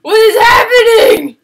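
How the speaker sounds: high, loud panicked cries; panicked screaming. Real, not acted. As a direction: shriek it in real panic. Panics at two monsters.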